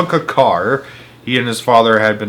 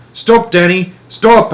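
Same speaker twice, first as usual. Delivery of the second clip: sharp, loud, high warning; father warning his son away from the pit; shouting; 8 semitones higher, 6 dB louder, slower than usual. A narrator's reading. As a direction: shout him to a halt. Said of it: "I shouted".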